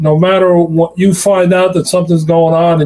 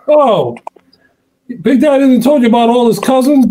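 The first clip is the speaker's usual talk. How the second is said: quick, higher teasing voice as Larry; imitating Larry; 8 semitones higher than usual. The caller teasing the host about cousins, a fast higher tease. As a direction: play Larry needling him, fast and pitched up. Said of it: "Larry Fitzgerald called into the show".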